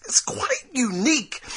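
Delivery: hushed, breathy secret; whispering. Acted. Stage-whispered product claim.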